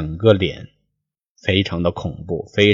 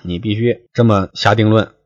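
usual speaking voice

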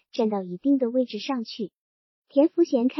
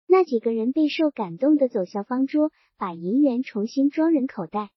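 plain reading voice

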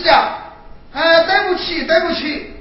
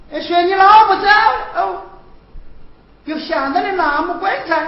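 ordinary delivery

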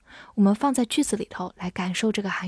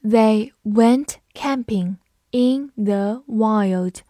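very slow, each word drawn out; slow teacher reading; much slower than usual. Shown as a demonstration. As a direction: read it slowly for learners. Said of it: "我们还是慢读一遍".

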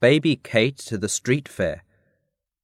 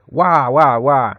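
put-on storybook baby crying noise; mock wailing, a little raised; imitated baby wail; 2 semitones higher, 4 dB louder, slower than usual. A narrator's reading. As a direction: read out a baby's wail, drawn out. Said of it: "and still she cried".

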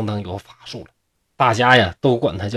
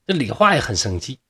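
usual speaking voice

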